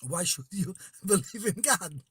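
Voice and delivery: ordinary talk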